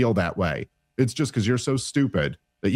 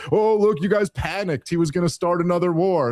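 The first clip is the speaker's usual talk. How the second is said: mocking Trump voice; 8 semitones higher than usual. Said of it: "He's go".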